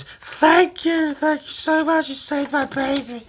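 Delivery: very high, hammed-up mother voice; high-pitched mock overjoyed parent; grateful mum. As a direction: gush thanks in a squeaky falsetto. Voicing the tearful mum.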